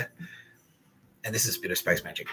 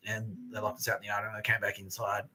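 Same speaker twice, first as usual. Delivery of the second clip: ordinary delivery, nothing marked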